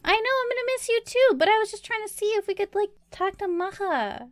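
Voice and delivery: high, quick in-character voice; heartfelt goodbye. Acted. Answering a friend who will miss her, high and hurried. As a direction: rush it out, pitched well up.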